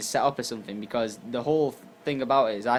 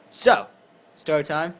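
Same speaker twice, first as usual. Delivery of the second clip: higher and louder announcement; cheerful story-time call; announcing; 5 semitones higher, 7 dB louder, slower than usual. Real, not acted. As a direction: kick off story time with a lift.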